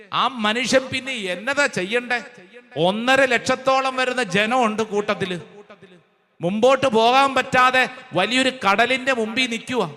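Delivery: normal talking voice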